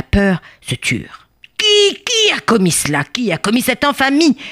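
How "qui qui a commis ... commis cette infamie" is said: high-pitched royal outrage; beside himself. Acted. King questioning the terrified bees, beside himself with rage. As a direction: demand it high, repeating in outrage. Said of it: "Le roi était hors de lui".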